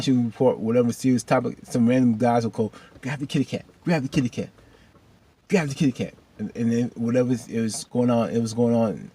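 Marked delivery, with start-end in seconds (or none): 3.0-6.1 higher-pitched, chanting catcall; repeated mocking shout-out, pitched up; imitated heckler. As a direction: call it out like a prankster heckling. Imitates random guys heckling a reporter.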